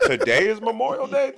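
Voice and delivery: high, exaggerated fake astonishment; mock surprise. Teasing the drunk woman about the date, mock surprised. Mocking the heckler's confusion.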